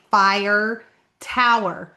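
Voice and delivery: "fire tower" slowly, both syllables of each word; careful pronunciation. Shown as a demonstration. Realizing what locals meant by "fire tower", slow careful pronunciation. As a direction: spell out "fire tower" by ear, FI-er TOW-er. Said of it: "it hit me. They meant".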